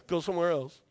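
usual speaking voice

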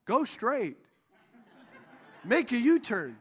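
even, neutral phone-assistant voice; calm Siri voice. Retold or imitated. Pastor imitating Siri, calm and even. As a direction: give directions like a calm GPS. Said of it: "there's no attitude".

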